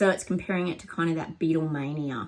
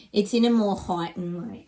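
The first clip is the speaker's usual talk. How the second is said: usual speaking voice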